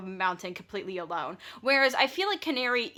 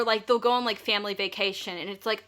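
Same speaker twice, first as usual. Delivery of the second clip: normal talking voice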